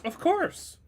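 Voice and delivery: high, bright eager yes; eager character voice. Acted. Character eagerly accepts shot.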